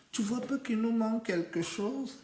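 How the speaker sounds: storyteller voicing a coaxing wife; wife in a tale. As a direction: ask it high and gently, as the wife. Wife's line voiced in tale.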